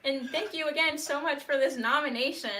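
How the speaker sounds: breathless, thrilled thanks; acceptance speech. Real, not acted. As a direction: gush with real excitement. Surprised winner accepting award.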